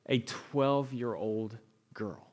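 usual speaking voice

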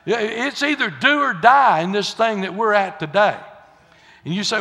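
ordinary delivery

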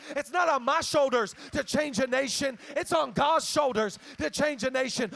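rising preacher cadence, loud; preaching. Real, not acted. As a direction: drive the contrasts home. Preaching builds to full force.

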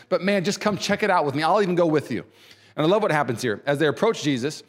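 usual speaking voice

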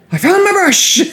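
high, loud shout of triumph; excited shout. Retold or imitated. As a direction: burst in thrilled at finding it. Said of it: "as she comes running down the stairs".

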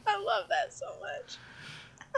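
slow, squeaky words through laughter; laughing while talking. Real, not acted. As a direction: gush through giggles. Delighted by the DeVito news.

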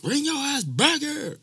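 high, drawn-out yell; top-of-lungs scream. Retold or imitated. Screaming at his dog chasing a skunk, high yell. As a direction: holler high after a runaway dog. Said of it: "I screamed at the top of my lungs".

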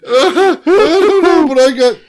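high squeal through tears of laughter; giggling fit in the middle of a sentence; laughing helplessly. Real, not acted. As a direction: laugh so hard the words barely come out.

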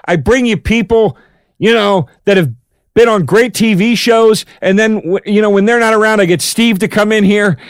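loud, high-pitched exasperation at listeners; mock angry rant. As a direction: berate the audience in mock outrage. Mock rant at absent callers.

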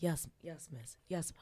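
lightly imitated obedience, low; submissive client. Client nodding along to his mistress, lightly submissive. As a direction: repeat it meekly, dropping the pitch.

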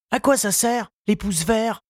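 lightly voiced young boy, pitched high and eager; a boy's light high voice, keen to finish; Tistou's voice. Tistou reassuring his gardening teacher, in a high boyish voice.

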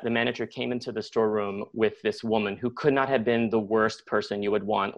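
ordinary delivery

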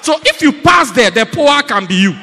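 very loud, emphatic sermon shout; full-force preaching. Real, not acted. Preacher warning anyone who passes the line of fire, full-force preaching. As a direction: thunder the warning at the congregation.

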